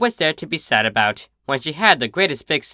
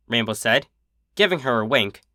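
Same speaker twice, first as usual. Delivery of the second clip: plain reading voice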